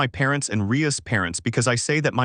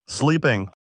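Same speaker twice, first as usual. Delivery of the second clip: ordinary delivery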